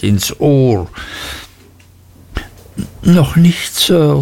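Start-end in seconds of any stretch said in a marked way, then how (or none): 3.0-4.2 higher, timid answer while flinching; boy answering the headmaster while his ear is pinched; boy in pain. A narrator's reading. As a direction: shrink back and answer politely. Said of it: "sagte ich, vor Schmerzen zurückweichend".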